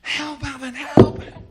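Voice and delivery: high, loud panicked cries; screaming for help. Retold or imitated. Panicking swimmer screams to his buddy on shore, high urgent scream. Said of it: "I start screaming".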